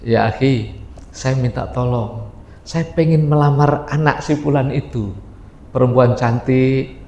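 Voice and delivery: Salman asking a favour. Acted. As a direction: voice him low and modest, like a friend asking help.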